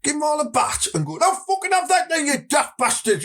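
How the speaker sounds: high-pitched, hammed-up Middlesbrough accent; mock threat in broad Middlesbrough; worst Borough accent. Shown as a demonstration. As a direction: lay the Boro accent on thick, high and aggressive. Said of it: "put your worst borough accent on".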